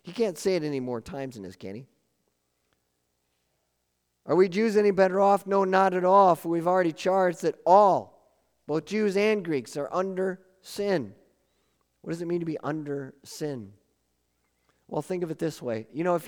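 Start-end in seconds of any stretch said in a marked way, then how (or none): 4.2-11.1 raised, forceful scripture reading; preaching scripture aloud. Acted. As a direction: proclaim it to the room.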